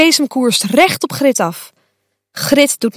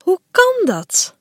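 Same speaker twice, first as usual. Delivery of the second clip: higher, slow puzzled question; surprised; 7 semitones higher, slower than usual. Acted. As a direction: wonder aloud, baffled. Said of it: "Fuchsia kijkt verbaasd".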